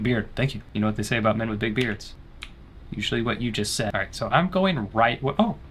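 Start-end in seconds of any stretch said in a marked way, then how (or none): none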